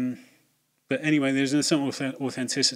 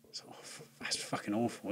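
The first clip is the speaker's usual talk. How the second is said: whisper; 11 dB quieter than usual. Real, not acted. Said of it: "whispered aside on bad line".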